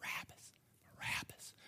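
whispered name, planted in the crowd; conspiratorial whisper. Acted. Chief priests stirring up the people, secretive whisper. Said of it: "stirring up the people".

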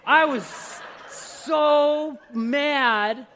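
loud, exasperated emphasis; retold fury. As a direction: relive being furious, drawing out each word. Retelling his road rage, acted.

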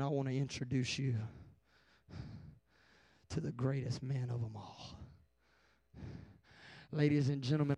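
low, soft solemn preacher voice; hushed preaching. Real, not acted. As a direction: draw the congregation in quietly, with weighty pauses. Hushed preaching before an altar call.

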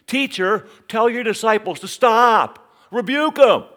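scandalized Pharisee calls out to the teacher; indignant Pharisee. Acted. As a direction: play the outraged religious leader rebuking. Mocking religious leaders' demand.

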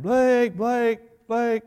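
high calling shout; calling a lost child. Retold or imitated. Staff calling out for a lost boy, loud and high. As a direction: call his name across the store. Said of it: "And they yelled".

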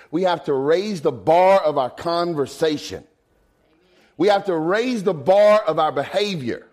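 a pastor pressing the flock to raise the bar; preaching at full force. Acted. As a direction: preach it hard to the congregation. Preacher builds to exhortation.

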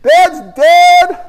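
put-on high, loud child's cry for mom; panicked yell. Little boy yelling to mom from the pool. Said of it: "I'd start yelling".

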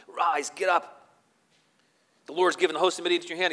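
loud, high battle cry; rallying call. Retold or imitated. Gideon rousing his men for battle, loud urgent call. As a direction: rouse the troops to rise. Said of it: "So he tells him".

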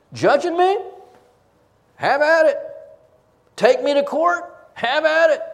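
loud, high, daring challenge; defiant shout. Acted. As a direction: fling it back at the critics as a dare. Preacher voicing Paul's defiance.

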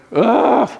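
a high exasperated ugh; short frustrated groan. Retold or imitated. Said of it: "I go".

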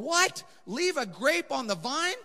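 high incredulous what; mock astonishment at the command; high, disbelieving listener. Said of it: "they're going".

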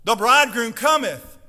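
loud, ringing herald's call; big announcement. Acted. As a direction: call it out to the whole village. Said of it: "there was a big announcement".

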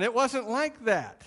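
ordinary talk

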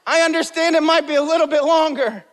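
laughing mock complaint, pitched high; mock protest. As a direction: tease the musicians playing him off. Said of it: "and I'm like".

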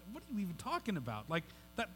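hushed, quick disbelief; soft, mildly incredulous Peter reaction; imagined incredulous response. Acted. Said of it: "I imagine, like, Peter's response".

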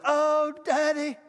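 put-on high, slow little-girl voice; imitated little daughter. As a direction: gush in wonder as a small child, voice lifted high. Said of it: "This is her. She goes".

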